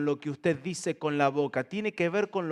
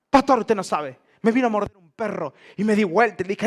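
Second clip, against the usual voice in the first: louder, eager churchgoer telling his tale; mock-excited believer bragging to the pastor; excited testimony; 4 semitones higher, 7 dB louder, faster than usual. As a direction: rush it out like a proud believer. Parishioner's excited dog testimony.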